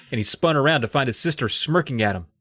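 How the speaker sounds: ordinary delivery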